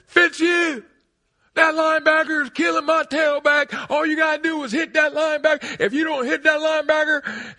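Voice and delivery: loud, high, angry coach shouting; furious coach yell. Retold or imitated. Coach yelling at the fullback who ran past Bubba, furious. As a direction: scream at the player from the sideline. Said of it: "the coach".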